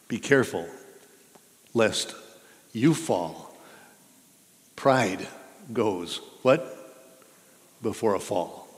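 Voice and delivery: ordinary talk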